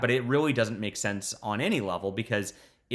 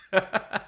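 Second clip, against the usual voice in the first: short laugh; 10 semitones higher, 3 dB louder than usual. Real, not acted. Laughs after insulting character.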